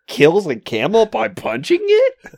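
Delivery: loud, high-pitched incredulous laughing question; all-caps disbelief. Retold or imitated. Film viewer reading own outraged notebook note aloud, incredulous. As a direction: read it like a note written in capitals, laughing. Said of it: "in capital letters I wrote".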